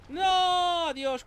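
high loud anguished no; despairing shout. Real, not acted. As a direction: cry out in dismay. Loses souls again.

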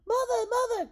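loud, high calling out. Acted. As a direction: call for mother across the castle. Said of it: "called out Radius".

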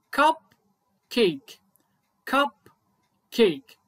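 كاب، كيك said as two separate syllables; syllables split apart. Shown as a demonstration. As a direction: pause between كاب and كيك. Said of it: "يبقى عندي التو سيلابلز كالآتي". (مقطعين منفصلين كاب كيك)

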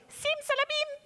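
put-on high-pitched magic formula for the kids. Shown as a demonstration. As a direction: squeak "Sim Salabim" up high like a tiny wizard. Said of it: "avec une voix très aiguë".

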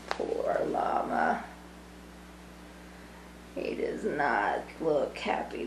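soft pitying whisper. Real, not acted. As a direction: breathe it out in sympathy. Sympathetic aside to the kids.

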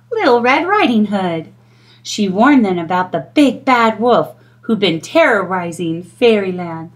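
usual speaking voice

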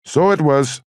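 high-pitched, slow Irish brogue. A narrator's reading. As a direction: call back in an Irish lilt. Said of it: "someone with an Irish brogue said".

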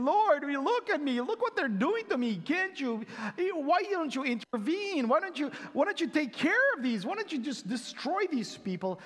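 high, complaining plea; whiny victim. Pretend. Imagined victim praying against his enemies, high whiny complaint. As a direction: wheedle God to take your side. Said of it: "feeling like the victim and saying".

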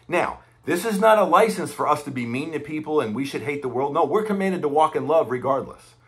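normal talking voice

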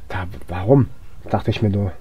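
ordinary talk, nothing marked

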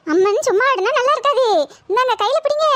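high, excited ritual instruction; urgent calling out. Acted. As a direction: push the ritual along urgently. Calling out amid the trance ritual. (உரக்க அவசரப்படுத்துவது)

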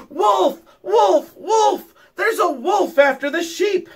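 high, loud alarm cry; boy hollering for help; yelling. A narrator's reading.

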